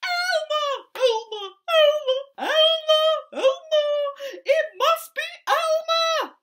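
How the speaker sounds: very high, loud, laughing calls; laughing elephants. A narrator's reading. The herd laughing as they recognise Elmer, high and loud. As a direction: call his name through laughter. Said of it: "helpless with laughter".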